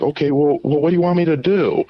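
imitating her curiosity; girl's voice. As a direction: say it up high, curious. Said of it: "she's like".

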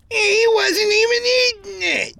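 very high, loud pitiful wail; shrieked, whining protest; pathetic voice. A narrator's reading. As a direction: wail it like a pathetic little victim. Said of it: "a pathetic voice rang out".

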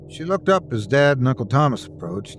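ordinary delivery

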